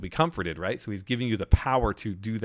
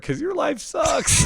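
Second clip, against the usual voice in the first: put-on much higher, slow, drawn-out childish wail; wailing little boy; 5 dB louder, slower than usual. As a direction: whine it like a sobbing kid. Crying child's punchline to dad.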